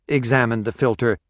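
ordinary delivery, nothing marked

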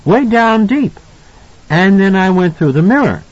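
put-on high, insistent child's voice; emphatic little boy. A narrator's reading. Boy correcting his mother, emphatic. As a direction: insist firmly as a small boy. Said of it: "Danny was very emphatic on this point".

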